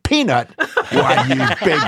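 high, affronted echo of an insult; mock indignation. [name] bristles at being called Peanut, mock outrage. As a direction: imitate bristling at a nickname.

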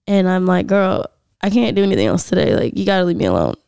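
ordinary talk

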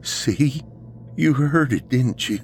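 high, eager insistence; urgent vindication. Acted. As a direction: seize on proof that you were right. Ryan vindicated when Dave hears it.

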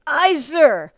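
high, loud, strained reply; choked voice. A narrator's reading. Bent-backed recruit answering drill master, choked shout. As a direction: force it out through a choked throat. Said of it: "Maggott's voice was choked".

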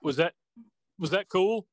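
halting mock fan question, rising at the end; Chris Farley. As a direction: lightly imitate an awkward interviewer, pausing mid-question. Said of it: "like the Chris Farley show".

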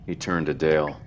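ordinary delivery, nothing marked

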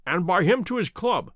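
plain reading voice

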